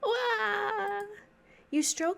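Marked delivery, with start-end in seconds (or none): 0.0-1.0 cartoon wail. Vanilla collapses wailing.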